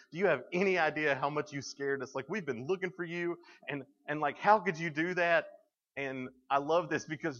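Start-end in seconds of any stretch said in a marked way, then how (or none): 0.1-5.5 put-on raised, higher voice scolding a lost child; a frantic mom letting her son have it; scolding mother. Mary scolding her lost twelve-year-old son, raised frantic voice. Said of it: "she, like, gets up in his face and grabs him and goes".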